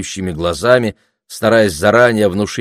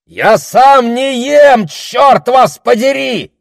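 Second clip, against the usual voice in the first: very high, slow, drawn-out shout; loud outcry; 7 dB louder, slower than usual. A narrator's reading. Pig seller defending himself to the crowd, shouting loudly. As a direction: yell back at the accusers. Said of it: "громко выкрикнул он".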